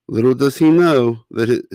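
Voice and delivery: usual speaking voice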